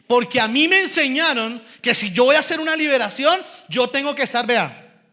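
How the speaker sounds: insistent preacher; forceful preaching. Real, not acted. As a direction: drive the lesson home from the pulpit.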